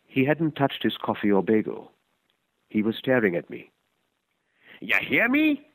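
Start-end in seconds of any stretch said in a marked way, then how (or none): 4.8-5.7 put-on much higher, gruff old stranger calling out; old man's voice. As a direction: demand his attention as the old vagrant.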